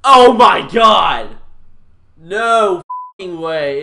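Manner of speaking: loud, slow disbelieving yell; shocked shout. Real, not acted. Reacting to barf bean.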